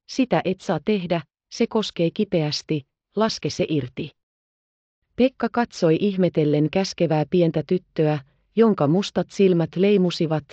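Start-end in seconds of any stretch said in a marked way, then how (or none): none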